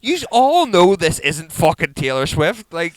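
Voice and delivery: loud, high incredulous protest; exasperated. Retold or imitated. Dad setting fans straight about a tribute act, exasperated.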